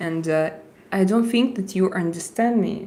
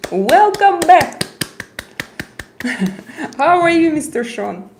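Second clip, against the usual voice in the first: excited welcome; 8 semitones higher, 7 dB louder than usual. Real, not acted. Greeting returning chat member.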